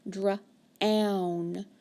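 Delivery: normal talking voice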